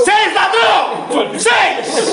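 high, drawn-out shout of a bid; truco-player yell. Pretend. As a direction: bellow it like a rowdy card player. Playful acted truco card-game call.

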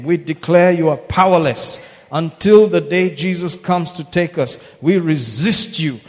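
fervent commanding prayer; laying a curse. Real, not acted. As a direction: pray down curses with authority.

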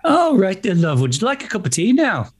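high, lilting mock Irish-Brummie; Birmingham attempt sounding Irish. Mirroring the coach's offer of tea, Irish-sounding Brummie accent. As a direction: copy Brummie but slip into Irish. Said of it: "that sounded really Irish".

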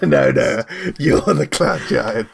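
real laughter running into the words; slow correction through a laugh. Real, not acted. Player correcting the muddled giant, laughing.

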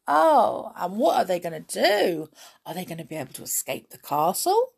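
high, animated oh-no reaction; dismayed suspense. Pretend. Storyteller asking what is going to happen, dismayed. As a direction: wonder aloud in mock alarm.

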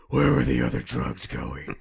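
loud, slow growled interrogation; over-the-top Batman demand. Acted. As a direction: roar it like Batman grilling a crook. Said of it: "when he's doing the Batman voice".

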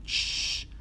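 long "shhh" from annoyed passengers; drawn-out shush. A narrator's reading. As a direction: hush the gigglers. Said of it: "los inmediatos".

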